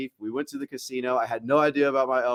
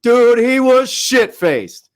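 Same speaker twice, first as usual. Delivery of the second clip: much higher, louder, slow; loud drawn-out exclaim; 12 semitones higher, 11 dB louder, much slower than usual. Real, not acted. Telling his friend how drunk Michael got, loud and slow. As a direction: stretch it out loud in disbelief. Excited retelling.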